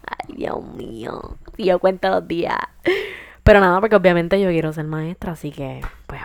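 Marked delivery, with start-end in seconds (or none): 0.0-2.6 weary sigh. Retold or imitated. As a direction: groan it like you can't wait to quit. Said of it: "Y yo".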